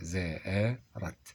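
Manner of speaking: زاء رت split apart, very slow, the zaa held long; syllable by syllable. Shown as a demonstration. Teacher sounds out the hamza for students, very slow. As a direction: break زأرت into two slow syllables. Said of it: "لازم النطق مهم جدًا".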